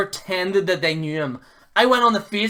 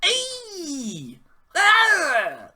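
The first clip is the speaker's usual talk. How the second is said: high, strained hammed-up yelp; a mobster caricature yelling hey; mock Italian-Mexican tough guy; 10 semitones higher than usual. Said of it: "doesn't know whether he's Mexican or Italian".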